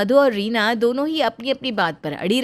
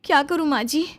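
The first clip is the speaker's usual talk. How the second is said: lightly voiced young wife, higher and anxious; worried young bahu; 6 semitones higher than usual. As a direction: plead gently with your mother-in-law. Bahu Reena, worried, to mother-in-law. (हल्की ऊँची आवाज़ में परेशान बहू)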